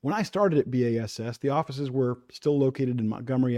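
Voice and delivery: ordinary talk, nothing marked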